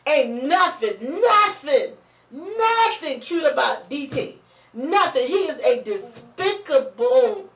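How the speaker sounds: slow, drawn-out emphatic indignation; worked-up, getting heated. Real, not acted. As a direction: stretch each word as the outrage climbs. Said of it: "take my level down 'cause I'm getting up there".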